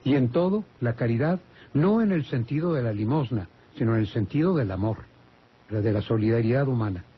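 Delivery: normal talking voice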